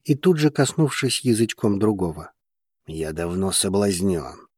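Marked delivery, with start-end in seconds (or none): 2.9-4.5 low, slow, soft male reply; gloomy mutter. A narrator's reading. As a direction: mumble it glumly, low and slow. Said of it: "хмуро пробормотал мужчина". (хмурое медленное бормотание низким голосом)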